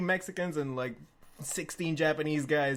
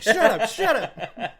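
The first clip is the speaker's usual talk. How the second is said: much higher, louder, slow laughing yell; 6 semitones higher, 10 dB louder, slower than usual. Retold or imitated. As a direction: yell at him to shut up. Narrator silencing friend.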